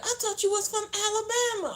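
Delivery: imitating a neighbor in a high-pitched voice; amused mimicry. Retelling neighbor's wrong guess at her origin, amused high mimicry. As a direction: retell it way up high, tickled by the mistake.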